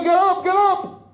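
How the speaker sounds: urgent call. Acted. Family shaking the fallen man.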